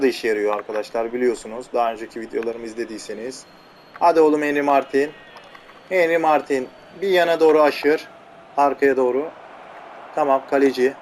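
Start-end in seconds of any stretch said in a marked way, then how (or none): none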